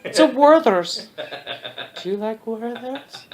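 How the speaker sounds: put-on high, soft, lingering old lady voice; creepy kind old lady. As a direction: offer it with creepy, excessive sweetness.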